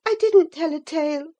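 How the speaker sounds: high, quick, a touch frightened denial; lightly voiced girl protesting; defensive girl. A narrator's reading. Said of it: "she stammered".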